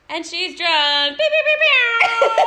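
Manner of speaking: loud, drawn-out announcement breaking into high beeps; hammed-up drunk-contest call with long high beeps; imitating a YouTuber. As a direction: stretch it out loudly, then squeal the bleeps. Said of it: "she was like".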